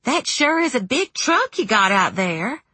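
high folksy cashier voice; high-pitched, chatty clerk; chatty store clerk. A narrator's reading. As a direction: make small talk high and folksy. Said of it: "Beverly commented".

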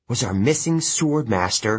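usual speaking voice

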